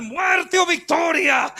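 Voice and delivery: drawn-out, rallying shout; slow war cry. Retold or imitated. Preacher voicing soldiers shouting their battle cry, slow. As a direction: cry it to the whole army. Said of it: "se levantaban y decían".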